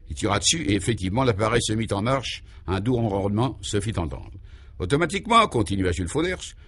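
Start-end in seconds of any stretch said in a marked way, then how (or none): none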